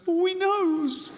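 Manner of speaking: high, slow, dreading voice; fearful inner voice. Retold or imitated. Fearful inner voice panicking at being caught staring, high and slow. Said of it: "This one goes".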